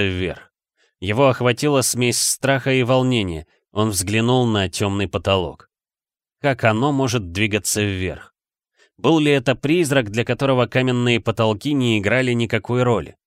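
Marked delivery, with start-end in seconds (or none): none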